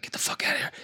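hushed, voiceless shooing of the dog; whispered shoo. Retold or imitated.

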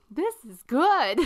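a hint of a happy dweeb; nerdy guy tasting. To his two friends, the nerdy guy praises the toast, lightly mock-pleased. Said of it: "he looks at the other two guys and he's like".

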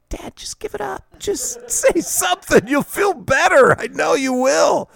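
very high, sing-song coaxing; a son silently coaxing his father; pleading kid's thought. Retold or imitated. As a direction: urge dad to finally swear, teasing.

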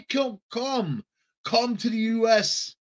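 a hint of a beckoning politician; mocking Biden's talk. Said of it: "That's the way Biden talks".

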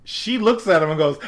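ordinary delivery

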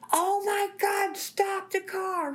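high, slow, drawn-out panicked wail; terrified pleading. Retold or imitated. Passenger begging the driver to stop, high drawn-out wail. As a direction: beg like you're sure you'll die.

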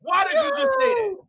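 high incredulous whine. Real, not acted. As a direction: push it up high like you can't believe it. Pressing, voice jumps up.

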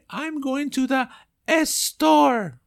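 a hammed-up wrong estore; mock Spanish accent. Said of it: "qué feo se escucha store".

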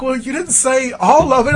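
high retold wife, lightly voiced; imitating wife. Wife teasing him about shaving, mock voice. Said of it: "She's like".

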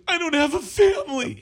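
high, loud hammed-up wail; mock crying. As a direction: fake sob the line like a wronged girl. Mocking crying girl's line.

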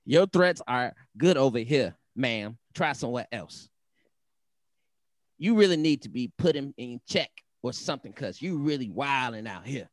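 put-on sassy, mad-girl read with swooping emphasis; angry woman voice. Host reads out Montana's post, sassy angry woman. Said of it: "Is that your angry woman voice?".

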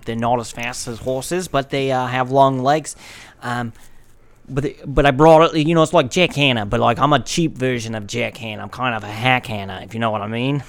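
Australian wildlife presenter spoof; Jack Wallaby, Australian accent. Acted. Presenting his horse dog to the host, Australian accent. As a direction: play an Australian animal showman. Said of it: "Like Jack Wallaby".

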